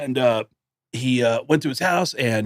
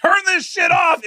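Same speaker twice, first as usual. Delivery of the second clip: high, loud, slow pleading yell; panicked shout; 4 dB louder, slower than usual. Retold or imitated. First Slayer listen, high-pitched panicked yell to turn it off. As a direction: beg him to kill the music. Teen begging to stop Slayer.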